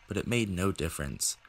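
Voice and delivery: plain reading voice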